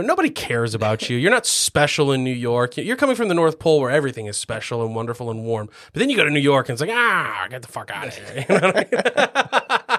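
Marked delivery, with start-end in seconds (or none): 0.0-2.8 low, grumpy brush-off; New York grumpiness. Acted. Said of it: "the grumpiness of New York City". 6.9-8.4 low grumbling dismissal; mock New York grumpiness. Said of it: "the grumpiness of New York City".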